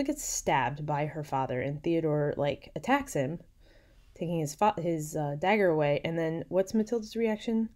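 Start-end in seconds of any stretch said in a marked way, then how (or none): none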